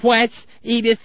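lightly voiced Archie Bunker snapping at his wife; a hint of Archie Bunker calling to Edith. Host mimicking Archie Bunker barking at Edith, lightly. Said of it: "Archie Bunker moments".

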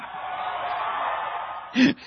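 long high-pitched cackle; wild cackle. Real, not acted. Host delighted by the speech, cackling.

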